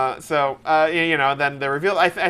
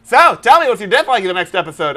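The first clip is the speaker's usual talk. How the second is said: TV host voice; 3 semitones higher, 7 dB louder than usual. Retold or imitated. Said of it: "here's an episode of Talking Dead".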